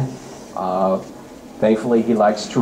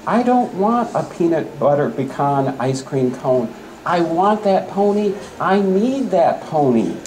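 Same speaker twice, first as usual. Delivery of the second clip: put-on a higher, whining child's demand; whiny demanding girl; 7 semitones higher than usual. Girl demanding the pony from her parents, higher and whining. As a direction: insist like a spoiled little girl.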